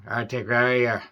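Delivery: Arctic Warrior with a light Irish lilt, dropped r in Arctic; Irish accent. Retold or imitated. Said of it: "Gabriel Byrne's accent was coming out".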